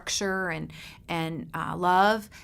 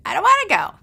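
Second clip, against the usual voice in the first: put-on high, loud childish whine; whiny child; 11 semitones higher, 9 dB louder, faster than usual. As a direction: play the sulky twelve-year-old refusing. Daughter voiced refusing trip.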